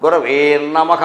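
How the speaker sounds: "గురవే నమః" slurred into one with a drawn-out nn; wrongly doubled n. Shown as a demonstration. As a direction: stretch the doubled n starting నమః straight after గురవే. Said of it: "నా కింద నా ఒత్తి ఎడతాడు".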